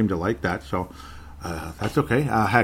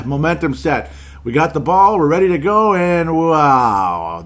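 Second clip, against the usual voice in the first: loud, high hype tailing into a long wow; hammed-up cheer that deflates on the last word; mock hype; 6 semitones higher, 6 dB louder than usual. Mock hype before the letdown.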